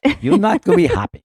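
high, fast, bad Swedish accent. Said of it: "very poor Swedish accent".

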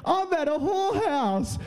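high, breaking into real laughter; laughing. Real, not acted. Retelling his drunk discovery, laughing through it. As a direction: wheeze the words through laughter.

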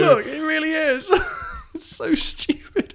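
high, squeaky, laughing too hard to speak; helpless laughter. Real, not acted. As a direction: squeeze the words out through laughter. Cracking up at her own accent.

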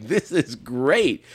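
delighted, slow and loud; gleeful. Retold or imitated. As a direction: savor every word of the gore. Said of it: "And I was like".

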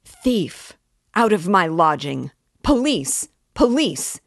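loud, high shout of a landlady; Amalia yelling. Acted. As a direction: scream for the police. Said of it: "yelled Amalia Ivanovna".

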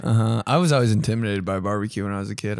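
usual speaking voice

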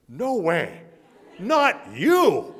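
he blurts it out in shock; stunned disbelief. Retold or imitated.

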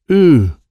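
loud, high "ooh". A narrator's reading. Child holding up two fingers, loud ooh.

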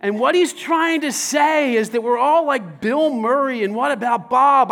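normal talking voice

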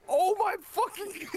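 high, drawn-out cry, cracking up; retold yell. Storyteller replays his horrified cry at drive-through, retold yell. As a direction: wail it slowly, high, through laughter. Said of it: "like, I literally yell out".

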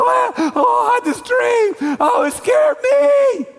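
high-pitched hammed-up wail, loud; mock crying. King waking from a scary dream, mock crying. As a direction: bawl like a frightened baby, comically overdone. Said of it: "crying, and like".

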